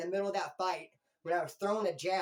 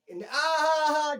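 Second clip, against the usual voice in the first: scoffing exclamation; 8 semitones higher, 9 dB louder than usual. Retold or imitated. Refusing to show tattoo.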